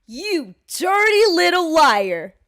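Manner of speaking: loud, drawn-out mock outrage; hammed-up slow shout; acted furious accusation. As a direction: stretch it out and holler it like a scandalised girlfriend. Acts storming out in confrontation.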